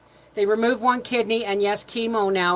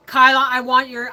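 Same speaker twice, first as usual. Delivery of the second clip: ordinary delivery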